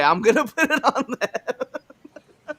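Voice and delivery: slow words broken by a high laugh. Real, not acted. Co-host blaming the song lyrics, laughing. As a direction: laugh it off while shifting blame.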